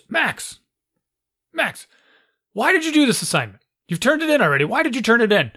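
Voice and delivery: high-pitched scolding teacher; stern teacher impression. Teacher questioning Max about early homework, exasperated teacher voice. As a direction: imitate a teacher calling a pupil out. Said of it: "this is how she sounds".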